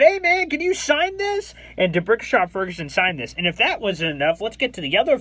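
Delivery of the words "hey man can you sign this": eager fan plea. Retold or imitated. Asking player for autograph.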